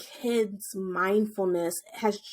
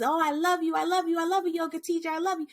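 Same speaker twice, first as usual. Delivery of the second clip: lightly voiced gushing child, higher; gushing little kid; 6 semitones higher, much faster than usual. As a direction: coo it like an adoring little kid. Kids showering teacher with hugs.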